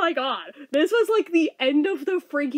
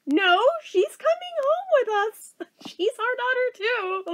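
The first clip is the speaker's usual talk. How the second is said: high-pitched imitated mother voice; insistent mom; 9 semitones higher than usual. Mom telling child the baby comes home, high mock insistence. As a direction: play mom laying it down, pitched way up.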